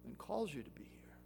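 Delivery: hushed, unvoiced appeal; whispered plea. Preacher pleading softly with the congregation, whispered. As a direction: drop to a whisper to draw them in. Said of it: "shift to whisper".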